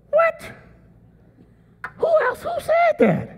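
very high, squeaky astonished cry; mock shock. Preacher reacting to Gideon's self-pity, high mock shock. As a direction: act scandalised by what he heard.

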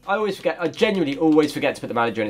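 ordinary talk, nothing marked

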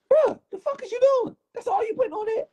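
very high, incredulous friend; shrill mock disbelief; squeaky outraged voice. Craig quoted barging in.

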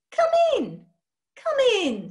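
high, loud eager greeting; beaming schoolmistress calling come in; eager welcome. Acted. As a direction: sing out a brisk, delighted welcome. Eager witch greets boy at door.

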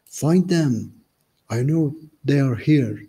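captain's shout. Acted. Captain ordering his men to find them, shouting. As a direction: bark the order to search. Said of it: "The captain shouted".

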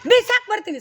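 ಬಿಸಾಕ್ hit loud and high. Shown as a demonstration. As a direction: threaten it with full force. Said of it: "ಕಮ್ಮಿ ಆಯ್ತು".

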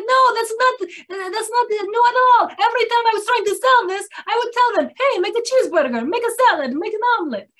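high, loud retelling of a Greek businessman's protest; animated Greek businessman. Retold or imitated. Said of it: "not supposed to do a Greek accent".

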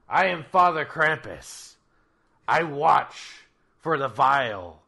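loud commanding Krampus; booming voice. Acted.

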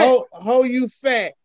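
hammed-up register call with a stumbling start; mock roll call. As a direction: draw the name out as if taking attendance. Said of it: "can you imagine roll call at school?".

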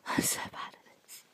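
embarrassed whisper to yourself. Real, not acted. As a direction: breathe it out, ashamed. Embarrassed aside after dying.

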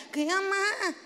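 put-on childish whine. Child questioning mother, laughing.

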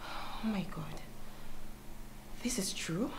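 breathless stunned whisper; shocked whisper. Acted. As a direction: whisper it in disbelief.

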